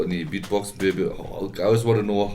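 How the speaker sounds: normal talking voice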